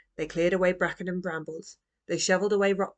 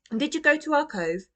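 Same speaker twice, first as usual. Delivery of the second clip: put-on much higher, eager little girl; eager child; 9 semitones higher, 4 dB louder than usual. A narrator's reading. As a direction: ask it like an excited child. Roo asks eagerly.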